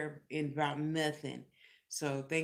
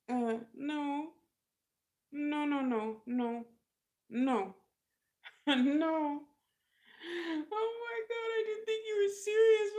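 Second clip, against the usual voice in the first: much higher, tearful laughing nos; Marcy laugh-crying; 11 semitones higher, slower than usual. Retold or imitated. Marcy admits she didn't believe it, laugh-crying much higher. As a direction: blubber and giggle at once.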